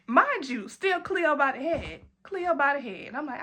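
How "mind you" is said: ordinary talk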